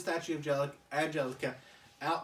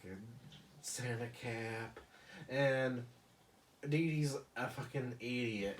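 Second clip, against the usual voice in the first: ordinary talk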